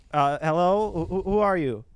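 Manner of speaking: high, loud, stammering question; nervous challenge. Acted. Accountant confronts the figure in the doorway, nervous and stammering. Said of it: "The accountant says".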